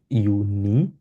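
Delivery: ইউনি drawn as "yoo-nee". Shown as a demonstration. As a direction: say ইউনি with Y, lifting the ending. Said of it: "এটা হচ্ছে ইয়ট". (ইউনি, শুরুতে ইয় ধ্বনি)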